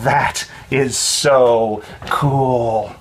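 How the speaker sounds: very slow, drawn-out words; awed admiration. A narrator's reading. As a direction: linger on every word, impressed. Kids admire friend's hook hand.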